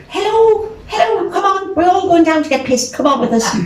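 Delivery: high, loud boisterous invitation; a rowdy rocker calling you out. Retold or imitated. [name] at the door.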